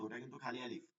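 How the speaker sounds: ordinary talk, nothing marked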